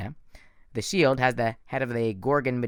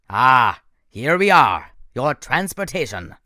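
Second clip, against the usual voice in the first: menacing villain; 9 dB louder, slower than usual. A narrator's reading. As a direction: command them like a cold monster. Thorne announcing helicopter.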